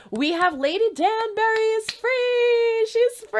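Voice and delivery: high, bright burst of delight; gleeful high announcement; excited. Real, not acted. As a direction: squeal the news that she's free. Excited news Lady Danbury freed.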